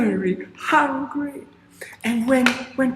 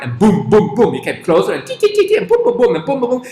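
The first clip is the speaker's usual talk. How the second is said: booming footsteps; 6 dB louder, faster than usual. Acted. Giant approaching.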